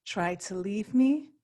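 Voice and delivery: slow, measured poem recitation; poetry reading. Acted. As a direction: read it line by line, slowly, with deliberate pauses. Poet reads her poem aloud.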